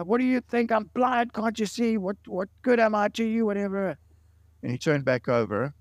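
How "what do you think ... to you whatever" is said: higher, louder angry blast; angry outburst. Retold or imitated. Blind Rudy snapping at the man in the next bed, angry and higher. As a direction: lash out bitterly at the man. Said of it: "he blasted this guy".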